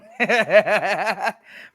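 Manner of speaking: a long, breathy chuckle; chuckling. Real, not acted. Cohost laughing at his own joke, chuckling.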